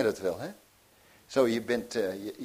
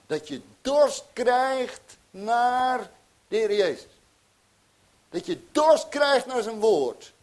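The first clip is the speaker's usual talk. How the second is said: impassioned preaching; 8 semitones higher, 8 dB louder than usual. Acted. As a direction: plead with the congregation. Climax of sermon appeal. (hartstochtelijk preken, hoger en luider)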